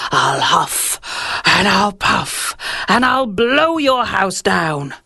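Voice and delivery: slow, lightly menacing wolf; a hint of a wolf's threat, drawn out; wolf threatening the pig outside his house; big bad wolf.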